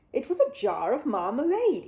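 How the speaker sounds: ordinary delivery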